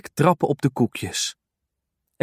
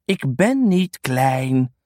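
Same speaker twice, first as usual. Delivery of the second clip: mopperend; much slower than usual. Acted. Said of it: "moppert Dirk".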